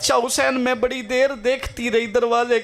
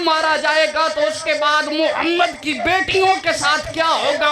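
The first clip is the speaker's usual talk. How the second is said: preaching at full force; 4 semitones higher than usual. Acted. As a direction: rouse the mourners at full pitch. Climax of majlis address.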